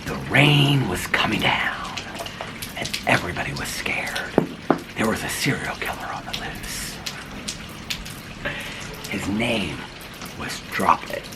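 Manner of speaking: hushed, creepy storyteller whisper; spooky whisper. Acted.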